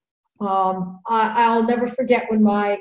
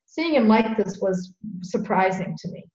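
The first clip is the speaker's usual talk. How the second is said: ordinary delivery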